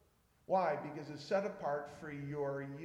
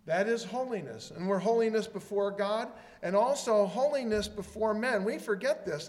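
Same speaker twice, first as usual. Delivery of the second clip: ordinary talk, nothing marked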